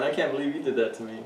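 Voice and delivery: usual speaking voice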